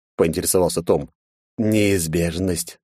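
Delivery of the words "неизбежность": slow drawn-out sigh. Acted. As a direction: sigh the word out slowly. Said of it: "вздохнул контролёр".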